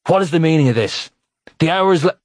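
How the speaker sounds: furious at the door, cut off; angry rebuke. A narrator's reading. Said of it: "In his rage ... his rebuke".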